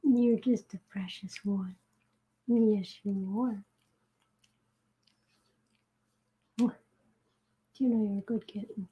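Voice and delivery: soft, slow cooing to a kitten. Real, not acted. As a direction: coo softly, almost whispering.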